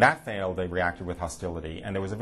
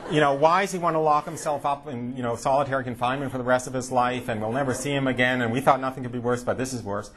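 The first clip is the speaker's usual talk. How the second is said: higher, louder mock lament with a laugh; 5 semitones higher, 3 dB louder than usual. Parents wailing that their son became a monk, higher and louder. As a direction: ham up the kvetching parent.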